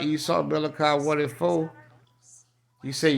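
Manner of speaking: ordinary talk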